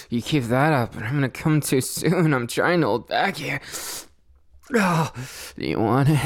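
ordinary talk

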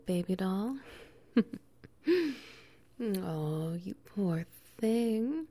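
normal talking voice

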